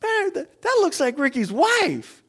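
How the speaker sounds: comic retold astonishment; imitated surprised brother. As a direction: play dawning disbelief in a high voice. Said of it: "He goes".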